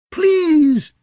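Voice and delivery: high, begging whimper. A narrator's reading. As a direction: plead through pain and fear. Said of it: "Dick whimpered".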